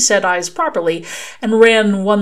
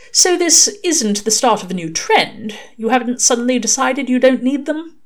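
higher-pitched questioning angel voice; Aziraphale, inquiring; 7 semitones higher, 3 dB louder than usual. A narrator's reading. Aziraphale asks about the bare eyes, inquiring. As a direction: probe gently in a lifted voice.

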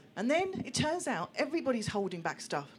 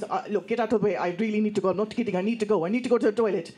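lightly acted desperate mum, louder; urgent pleading; 6 dB louder, faster than usual. Mum begging captors for the toilet, urgent and loud. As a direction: plead loudly to be let out to the toilet.

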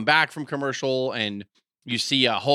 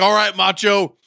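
loud, higher, hyped-up wrestler; jazzed up; 6 semitones higher, 6 dB louder than usual. Acted. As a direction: call out all pumped up, in mock excitement. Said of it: "he's all jazzed up".

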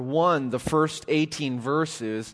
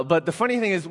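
normal talking voice

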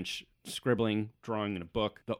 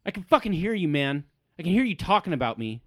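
high, loud irate halfling; angry halfling; 8 semitones higher, 7 dB louder, faster than usual. Acted. Halfling overhearing talk about him, angry high shout. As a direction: snap at them, pitched up and loud. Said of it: "you hear the halfling chime in".

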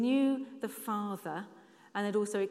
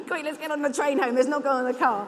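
louder joking quote through laughter; laughing; 5 semitones higher, 9 dB louder than usual. Real, not acted. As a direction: rush the line out while laughing. Joking mock quote while laughing.

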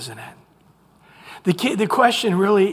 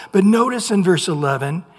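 ordinary delivery

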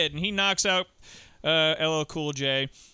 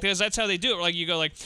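ordinary delivery

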